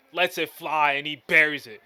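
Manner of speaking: big loud shot call; loud hype call. Real, not acted. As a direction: yell it as it drops.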